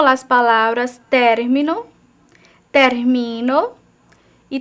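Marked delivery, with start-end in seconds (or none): 1.1-1.9 "término" stressed on the first syllable, TÉR. Shown as a demonstration. Teacher contrasting tonic syllables, "término" stressed on TÉR. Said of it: "hay una diferencia en la sílaba tónica". 2.7-3.7 "termino" stressed on the middle syllable, MI. Shown as a demonstration. Teacher contrasting tonic syllables, "termino" stressed on MI. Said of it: "hay una diferencia en la sílaba tónica".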